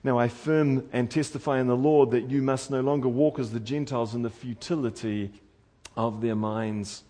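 ordinary talk

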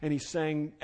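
usual speaking voice

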